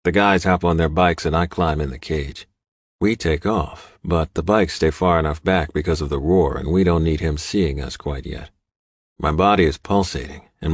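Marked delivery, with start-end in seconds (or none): none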